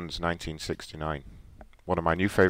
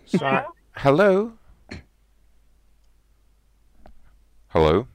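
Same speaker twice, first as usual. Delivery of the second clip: calling out hello; 8 semitones higher, 5 dB louder, slower than usual. Pretend. Prank call, shouting hello down phone.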